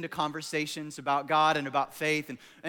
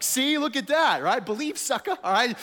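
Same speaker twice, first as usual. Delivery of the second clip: higher, louder hammed-up gloat; mock gloating shout; 7 semitones higher, 6 dB louder than usual. Showing off driving to a kindergartner, hammed-up gloating shout. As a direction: crow it like you just won a silly bet. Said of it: "you're like".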